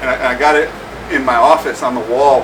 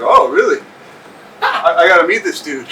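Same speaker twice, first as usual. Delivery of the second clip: high and loud, eager disbelief; retold excitement; 4 semitones higher, 5 dB louder than usual. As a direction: perk right up, suddenly keen to meet him. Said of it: "I was like".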